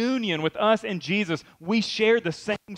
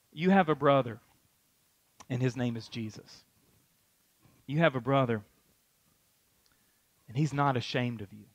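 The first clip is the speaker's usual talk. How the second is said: tender; 4 semitones lower, 4 dB quieter than usual. Acted. Jesus voiced speaking tenderly.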